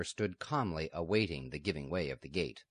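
ordinary delivery, nothing marked

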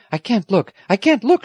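put-on high, distressed girl's cry. A narrator's reading. Girl hiding her face in grandfather's arms, crying out. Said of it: "she cried".

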